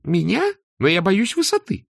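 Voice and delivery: high-pitched, anxious objection; acted nervous protest. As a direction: balk in a high voice. Arthur balks at being lifted. (испуганно, высоким голосом)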